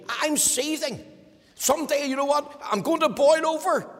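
a wife pouring out her anger at her husband, building to a near shout; venting wife. Acted. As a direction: let the grievance boil up. Acted wife venting, rising pitch.

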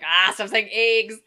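loud, drawn-out mock groan at a word; exasperated outburst. Listener complaining to the podcast episode, loud exasperation. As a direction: act out being fed up, slow and loud. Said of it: "I could hardly listen to that episode".

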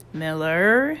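plain reading voice

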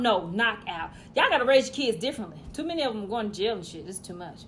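ordinary talk, nothing marked

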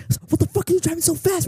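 high, fast mock yell; mock angry dad. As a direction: rattle it off like an outraged neighbor. Dad confronting driver, retold.

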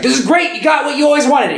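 high-pitched, excited imitation; mock elated. Friend bursting into hospital room, mock elated. Voicing an excited character from film.

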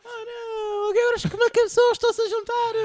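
high crying mock terror; panicked cry. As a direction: wail like fleeing villagers. Acting out panicked islanders.

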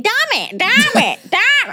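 put-on high-pitched child repeating a swear; toddler parroting. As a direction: chirp it like a parrot-like little boy. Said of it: "then he started saying".

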